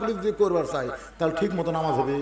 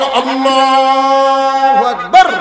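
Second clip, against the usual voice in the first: very high, loud, long-held call; loud drawn-out takbir; 9 semitones higher, 15 dB louder, much slower than usual. Acted. Shepherd calling takbir behind the muezzin, huge drawn-out shout. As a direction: cry it out slowly at full volume.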